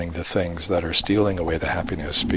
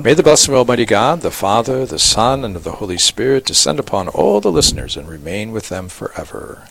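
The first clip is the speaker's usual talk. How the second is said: priestly blessing; 4 semitones higher than usual. Acted. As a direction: bless the listeners like a bishop at Mass. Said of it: "Will you part us with a blessing?".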